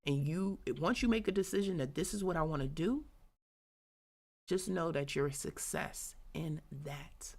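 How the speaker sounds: usual speaking voice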